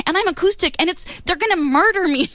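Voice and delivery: high, frantic panicked outburst; flipped out. Retold or imitated. Nervous singer to [name] backstage, high panic. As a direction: blurt out the panic. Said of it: "I finally just kinda flipped out".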